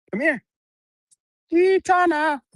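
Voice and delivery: loud, very high call to the dog. Real, not acted. Vlogger calling her dog back, loud high call. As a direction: sing her name out loudly to fetch her.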